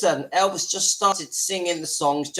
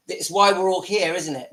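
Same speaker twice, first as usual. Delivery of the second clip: ordinary talk, nothing marked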